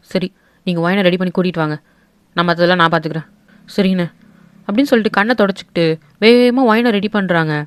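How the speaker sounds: deep, commanding uncle laying down the law; stern patriarch. Acted. The uncle ordering his sister to marry off the girl, low and stern. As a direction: speak low and firm, brooking no argument.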